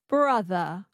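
"Brother" echoed with no final R; dropped R schwa. Shown as a demonstration. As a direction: copy "Brother" ending on uh. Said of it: "you drop the R and you make it a schwa".

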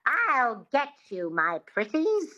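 high, slow, twangy witch voice; Wicked Witch. Shown as a demonstration. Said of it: "Think of the Wicked Witch".

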